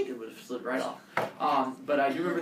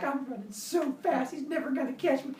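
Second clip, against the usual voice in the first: put-on smug kid voice; 10 semitones higher than usual.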